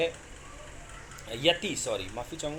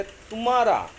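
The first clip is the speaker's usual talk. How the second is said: तुम्हारा pushed louder and higher on तुम्; stress on first syllable; 6 semitones higher, 6 dB louder than usual. Shown as a demonstration. Teacher giving the second example, तुम्हारा with heavy stress on तु. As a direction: hit the तु of तुम्हारा hard, closing onto the म. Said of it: "तू पे म के कारण बलाघात है".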